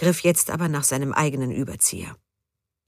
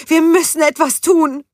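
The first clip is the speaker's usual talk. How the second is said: high, loud urgent plea; pleading; 13 semitones higher, 8 dB louder than usual. A narrator's reading. Judith urging Gunther to help the professor, high loud pleading. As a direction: press him to help. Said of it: "flehte sie".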